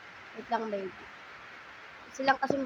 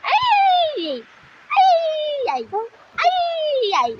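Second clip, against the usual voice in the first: delighted squeals; 8 dB louder, much slower than usual. Real, not acted. Mother squealing playfully at her baby. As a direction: squeal with delight, much higher.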